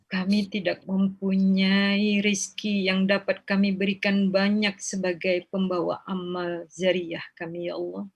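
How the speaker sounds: measured chanted supplication with long pleading pauses; leading a prayer. Acted.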